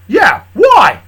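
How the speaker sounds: high, loud challenge; demanding shout. Acted. Questioner demanding how Charlie knew, shouting.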